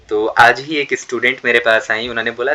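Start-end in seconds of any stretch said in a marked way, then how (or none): none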